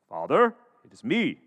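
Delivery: lightly disguised son's voice; put-on brother's voice. Jacob greets his blind father as Esau, lightly put-on voice. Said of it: "the accent".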